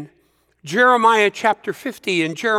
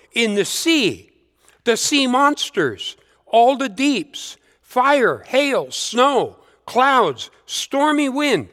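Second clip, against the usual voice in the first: rising, ringing psalm reading, each item lifted higher; scripture reading; 6 semitones higher, slower than usual. Acted. Preacher reading Psalm 148 to the congregation, raised and ringing. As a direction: lift every item of the psalm as a proclamation.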